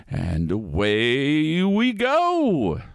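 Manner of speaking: slow, drawn-out launch call; spooky ride narrator. Acted. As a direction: stretch it out grandly.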